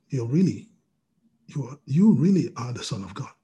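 ordinary delivery

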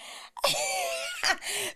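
laughing at her own wrong move; high laugh. Real, not acted. Laughing at herself.

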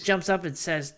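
normal talking voice

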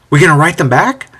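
mildly excited, rising question; eager excitement. A narrator's reading. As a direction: ask it suddenly, unable to hold back. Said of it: "Unable to curb his excitement".

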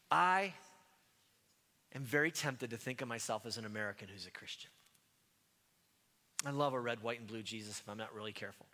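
ordinary delivery

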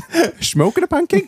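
laughing mock Dutch voice; high giggly Dutch accent. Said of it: "should have read it in a Dutch accent".

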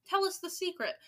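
eager crowd. Acted. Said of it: "cried the audience impatiently".

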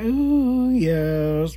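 high coy ooh; coy flirty squeal. Retold or imitated. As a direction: bat the eyes as you coo it. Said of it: "Be batting my eyes and everything".

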